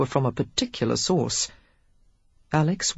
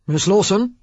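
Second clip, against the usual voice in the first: butler calling through the door; muffled through a door; 4 semitones higher, 6 dB louder than usual. A narrator's reading. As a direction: voice from behind a door. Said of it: "came the butler's muffled voice".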